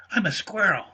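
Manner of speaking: high squeaky little squirrel voice; small squeaky critter calling down from a tree. Acted. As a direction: pipe it up like a tiny squirrel. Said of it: "said a squeaky voice".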